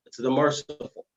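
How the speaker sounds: ordinary talk, nothing marked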